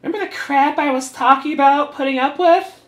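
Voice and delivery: high, loud mocking voice; put-on character voice. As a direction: ham up the complaint. Voicing character in comic.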